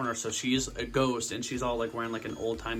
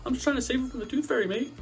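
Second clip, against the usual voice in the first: put-on creepy old lady; 10 semitones higher than usual. Old neighbour lady caught stealing.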